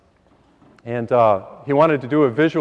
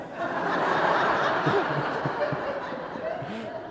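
soft airy chuckle at his own punchline; breathy laugh; 9 semitones higher, 8 dB quieter than usual. Real, not acted. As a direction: let a quiet breathy laugh roll out.

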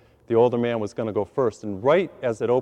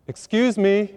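calling out; 7 semitones higher, slower than usual. Retold or imitated. Calling to the woman.

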